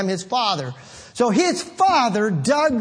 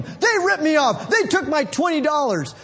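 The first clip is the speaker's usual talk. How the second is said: whiny complaint; 7 semitones higher than usual. Retold or imitated. Mocking a cheated complainer.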